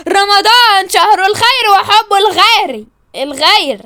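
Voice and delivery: declaimed slogan. Acted. Reminding quarrellers it's Ramadan, declaimed slogan. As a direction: proclaim it word by word.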